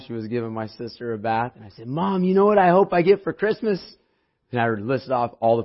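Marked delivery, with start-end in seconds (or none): 1.9-3.8 put-on excited kid. Child voice quoted to mom.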